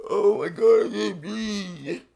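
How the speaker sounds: slowed, strained, half-laughing choke; mock breathless. Streamer to chat, mocking his own gasping, choking voice. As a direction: play it out of breath, over the top. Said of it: "every five seconds you go".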